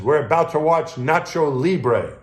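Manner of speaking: high, loud and slow announcement; retold call. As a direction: call it out slowly so it carries. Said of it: "yells up from the middeck".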